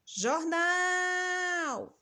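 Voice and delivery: Jornal with a high, long, held NAL; high sustained call on the NAL of Jornal; calling out, drawn-out. Shown as a demonstration. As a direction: call out Jornal, stretching the last syllable nal. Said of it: "Olha onde a gente demorou mais".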